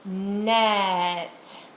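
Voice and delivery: "Net" with its sounds stretched out; said slowly. Shown as a demonstration. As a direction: draw out "Net" for the listener. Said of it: "watch my mouth as I say".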